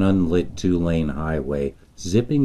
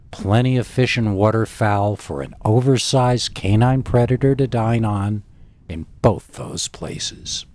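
ordinary delivery

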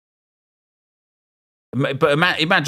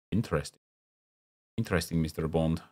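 mock Bond villain; 5 semitones lower, 9 dB quieter than usual.

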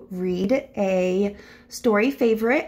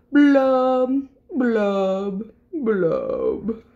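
glum fish blubbing; much slower than usual. Acted. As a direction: draw out each blub, sinking down. Gloomy fish's drawn-out blubs.

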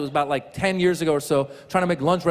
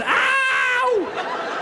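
long, high-pitched yowl; high sustained wailing "ow"; baby's scream. Retold or imitated. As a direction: scream like a rock-star baby. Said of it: "He starts screaming".

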